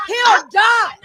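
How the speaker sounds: hyped-up shout of who he is. Real, not acted. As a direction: announce yourself boldly. Man declaring loudly to camera.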